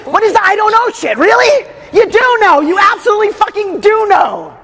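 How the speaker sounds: high, heated, pushing challenge; exasperated confrontation. Real, not acted. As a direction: challenge them hard, pitch way up. Coach confronting the crying client.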